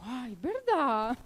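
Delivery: drawn-out, swooping mock wonder; retold realisation. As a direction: stretch it out as if it just clicked. Friend's retold reply.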